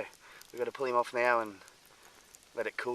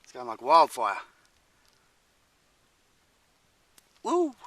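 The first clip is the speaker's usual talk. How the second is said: loud, delighted whoop; excited cheer; 10 dB louder than usual. Real, not acted. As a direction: cheer as it bursts alight. Fire catches, he cheers.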